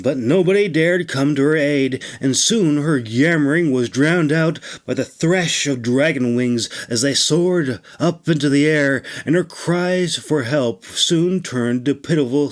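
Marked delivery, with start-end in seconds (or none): none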